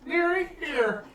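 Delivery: put-on sweet old lady calling out; old woman voice. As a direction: croon it like a kindly granny.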